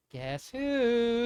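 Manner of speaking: lightly voiced energetic girl; a playful guess-who, sweet and girlish. A narrator's reading. Pearl surprising her cousin from behind, sweet girlish voice. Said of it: "said a sweet, energetic, girlish voice".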